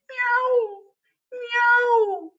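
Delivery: very high thin meow; cat meowing. A narrator's reading. Cat answering the children, high meow.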